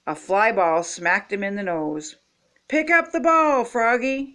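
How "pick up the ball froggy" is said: high, urgent sideline order; coach's yell. A narrator's reading. Coach urges Froggy to field it, yelling. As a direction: yell the instruction across the field. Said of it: "yelled the coach".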